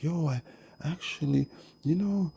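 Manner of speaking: very high put-on voice; imitating other people. People reacting when told the queen was switched, high-pitched imitation. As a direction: mimic them in a high-pitched voice. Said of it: "they're like".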